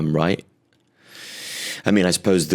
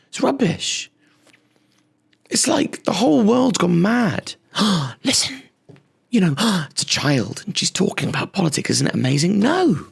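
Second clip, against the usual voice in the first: much higher mock indignant voice; mock pundit; 11 semitones higher than usual. Comic mocking the fawning press in a high squeaky voice. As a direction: keep the squeaky outraged pundit going.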